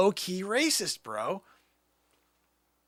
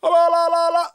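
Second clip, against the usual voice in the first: high, loud mock war whoop; 8 semitones higher, 9 dB louder than usual. Answering a request for an Indian accent, mock chant. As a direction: belt out la-la syllables high and loud. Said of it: "So you asked for an Indian accent".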